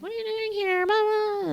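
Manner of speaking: very high, put-on squeaky quote; mock upset woman. Recapping her seeing him on the stairs, high mock upset. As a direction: mimic her startled question way up high. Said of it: "she's like".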